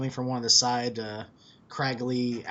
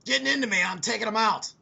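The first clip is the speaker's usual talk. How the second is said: higher, louder nervous warrior; tense companion Bo; 7 semitones higher, 3 dB louder than usual. Acted. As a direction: warn them he'll fight back. Bo answering tensely.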